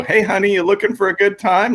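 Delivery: high-pitched woman's solicitation; imitated high female come-on; streetwalker's come-on. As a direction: coo it high, like propositioning a stranger. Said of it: "the woman tapping on the window".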